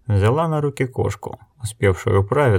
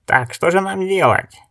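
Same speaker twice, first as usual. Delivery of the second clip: high-pitched, exasperated question; indignant; 10 semitones higher than usual. Acted. Elder challenges the blind woman on what to do, indignant. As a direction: protest with pitch jumping up. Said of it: "возмутился Гетц".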